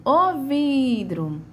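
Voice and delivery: high, slow "vidro" with "vi" held long; "vidro" sung out on a long "vi"; calling out stretched. Shown as a demonstration. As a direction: call "o vidro", stretching the first syllable. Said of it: "qual é a sílaba que eu estendo mais".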